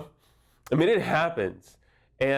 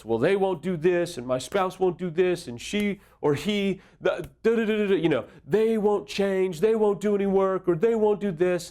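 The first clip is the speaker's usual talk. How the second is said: higher, whining list of gripes; mock whiny complaint; 7 semitones higher than usual. Unfaithful spouse's whining excuses, mock complaining. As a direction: mimic a spouse griping that nothing will change. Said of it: "For the unfaithful, it's".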